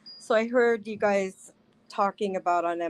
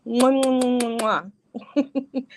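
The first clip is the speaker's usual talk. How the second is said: blowing kisses; 5 semitones higher, slower than usual. Real, not acted. Blowing kisses to chat viewers.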